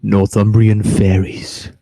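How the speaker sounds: low, slow, mock-spooky title; Alan Robson spooky voice. Announcing the fairy tale to the stream in a slow spooky voice. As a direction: drag the title out low like a ghost story host. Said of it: "do me Alan Robson spooky voice now".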